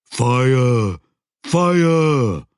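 high, loud creature bellow of alarm; a troll yelling a warning; loud troll shout. Acted. Troll urgently warning Joshua.

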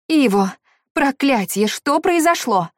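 high, loud frightened question over phone. A narrator's reading. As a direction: ask in fright. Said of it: "раздался испуганный голос".

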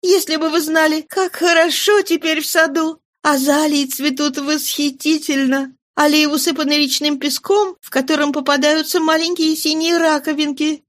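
put-on high young girlish voice, hurt and scolding; a daughter chiding her father for leaving; Cosette's reproach. A narrator's reading. Cosette scolds her returned father, high, reproachful.

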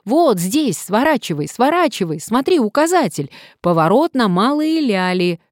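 loud, high, urgent shouting; sudden yell. A narrator's reading. Passenger suddenly yelling at driver to turn, urgent shout. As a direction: yell out the turn at the last moment. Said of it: "и вдруг завопил".

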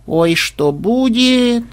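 high, slow fretful wail; drawn-out dismayed lament; upset sigh. A narrator's reading. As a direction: drag it out in worry. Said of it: "расстроенно вздохнула внучка".

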